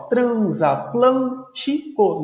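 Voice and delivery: "transatlântico" slowly broken into tran-za-tlân-ti-co; "transatlântico" spelled out in slow syllables. Shown as a demonstration. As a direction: separate each syllable of "transatlântico". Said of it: "fazer a separação completa".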